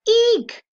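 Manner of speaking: very high loud squeak; piercing mouse squeal. Acted. Reader voicing the mouse caught by the cat, piercing squeal. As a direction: shriek like a pinned mouse. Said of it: "piercing squeal of a rodent".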